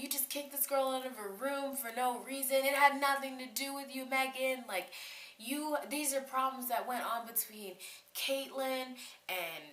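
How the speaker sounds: high-pitched indignant rant; sustained high mock yell, not full volume; retold screaming argument. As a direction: re-enact telling a roommate off. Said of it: "So I'm screaming at Megan".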